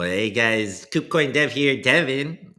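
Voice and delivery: a first, half-hearted stab at the dev intro voice; tentative put-on voice of a coin developer; mock crypto dev voice. Streamer trying out his coin-dev impression on chat. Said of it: "Wait, that's not even how I talk it".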